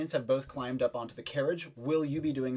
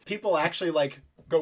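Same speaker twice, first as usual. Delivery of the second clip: ordinary delivery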